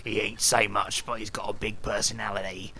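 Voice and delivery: jokey put-on Cockney bloke; fake Cockney accent. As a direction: banter in a comic Cockney voice. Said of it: "Fake Cockney Accents".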